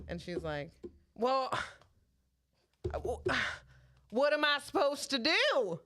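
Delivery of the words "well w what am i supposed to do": higher-pitched imitation of a flustered Southern customer; sighing, helpless mock Southern; Southern accent. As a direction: play the lost Southern lady. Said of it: "I did the accent".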